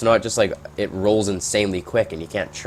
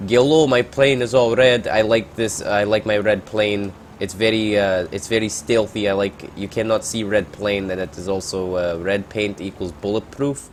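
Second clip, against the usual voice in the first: hammed-up Russian pilot bragging in broken English; a jokey Russian accent, fading toward plain. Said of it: "let's throw a Russian accent on that".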